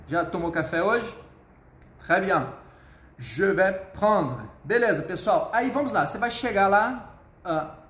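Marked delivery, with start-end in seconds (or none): 3.2-4.5 slow, stretched French demonstration; deliberate teacher's model; slow model phrase. Shown as a demonstration. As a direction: draw each word out for learners.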